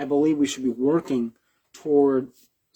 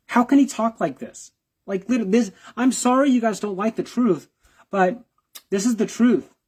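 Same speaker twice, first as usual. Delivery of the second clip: can't believe what he just heard; exasperated disbelief; 8 semitones higher than usual. Real, not acted. Exasperated reaction to clip.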